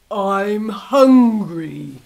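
slow, loud, growling wolf; hungry wolf. Acted. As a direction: drawl it out like a big hungry wolf. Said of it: "he grinned at her".

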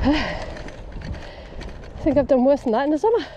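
panting between words; breathless after a climb; out of breath. Real, not acted. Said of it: "Okay, I'm out of breath".

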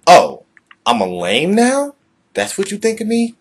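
high, loud hurt indignation; offended outburst. Acted. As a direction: take it personally, loudly. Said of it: "a conversation with ourselves".

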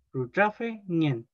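Rutrafe ñen drawn out slowly, part by part; slow model pronunciation. Shown as a demonstration. Teacher models Rutrafe ñen for students, slow and clear. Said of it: "hay que ir como por partes".

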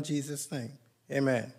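ordinary delivery

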